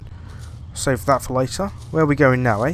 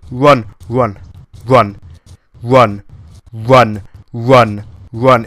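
loud frantic run; urgent shouts; 2 semitones lower, 9 dB louder, much slower than usual. Real, not acted. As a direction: yell it while fleeing. Fleeing monster.

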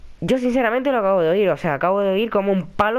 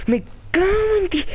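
high, shaken curse; rattled cursing; 10 semitones higher than usual. Real, not acted. Player swearing at the girl who scared him, rattled.

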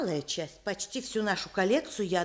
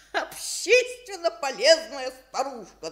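usual speaking voice

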